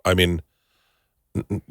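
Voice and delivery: ordinary talk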